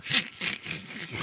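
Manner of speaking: wheezy, voiceless snicker. Retold or imitated. Retelling a funny Fat Boys scene, imitated snickering laugh. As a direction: do a hissing cartoon laugh. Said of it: "he's laughing like, uh, uh, Ernie".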